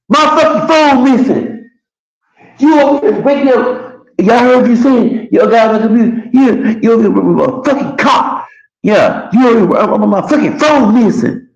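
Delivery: high, loud mocking rant of the dude; imitating a frantic angry man who lost his phone; crazy angry yelling. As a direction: play the dude losing it over his phone. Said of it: "act crazy".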